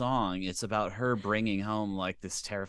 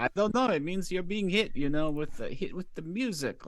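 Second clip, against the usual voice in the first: a higher-pitched Swedish accent, a hammed-up songwriter; 7 semitones higher than usual. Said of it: "That was my Swedish accent".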